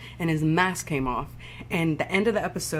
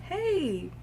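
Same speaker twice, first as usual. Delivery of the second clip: creepy greeting; 12 semitones higher, 3 dB quieter than usual. Retold or imitated. Prater greets Dexter at the door.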